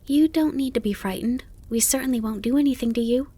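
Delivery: put-on polite, high child voice; Bambi. A narrator's reading. Fawn reassuring a startled grasshopper, polite. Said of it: "said Bambi politely".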